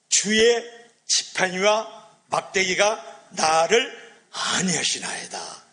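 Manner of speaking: loud, high, slow verse recitation; scripture recitation. Acted. Preacher quoting Psalm 23 to the congregation, slow loud recitation. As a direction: proclaim the psalm slowly, word by word.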